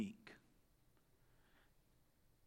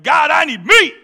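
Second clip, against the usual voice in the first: shouted, high, much louder demand; 7 semitones higher, 17 dB louder than usual. Retold or imitated. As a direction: yell it out front like a hungry complainer. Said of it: "out front like".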